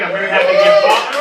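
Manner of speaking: loud, fast, high mock-cheerful yell; a shouted fake-happy claim of fatherhood; sarcastic shout. Real, not acted. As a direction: blurt it out overly happy.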